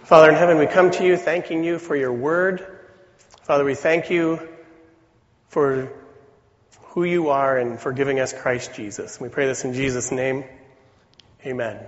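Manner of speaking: earnest spoken prayer. Real, not acted. Leads a prayer.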